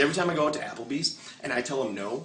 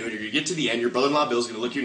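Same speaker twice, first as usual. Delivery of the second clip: ordinary delivery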